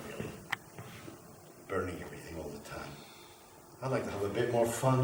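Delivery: very low, soft and dejected; lonely confession. Acted. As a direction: sink into a quiet, self-pitying murmur. Fire character confesses his loneliness.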